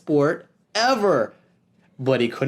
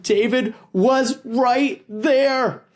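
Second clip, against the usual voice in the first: higher, slow dramatic reveal; sudden alarm; 10 semitones higher, 3 dB louder, much slower than usual. A narrator's reading. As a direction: land it like a gotcha. Narration turns suspenseful.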